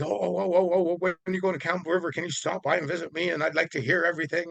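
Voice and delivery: high, fast, tumbling eager questions; all excited. Retold or imitated. Eager scientist on the phone to the speaker, high and fast with excitement. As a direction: bubble over with excitement, words rushing out. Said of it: "he just got all excited".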